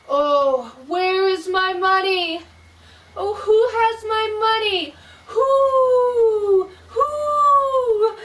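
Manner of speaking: very high, slow, wailing ghost voice; ghost crying. Acted. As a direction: wail from far off like a lost spirit. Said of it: "The ghost of the dead woman cried".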